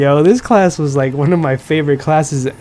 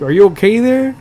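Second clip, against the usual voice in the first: teacher's catchphrase, pitched up and a bit louder; mock teacher voice; 6 semitones higher, 4 dB louder than usual. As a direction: mimic the clueless teacher, higher.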